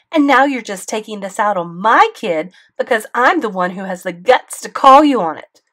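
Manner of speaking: loud, high angry tirade; mother ranting at the school board, loud fury; furious rant. A narrator's reading. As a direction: tear into the principal.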